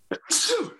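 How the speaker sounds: sharp sudden sneeze; sneezing. Real, not acted. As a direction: let out a real sneeze. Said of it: "gonna sneeze again".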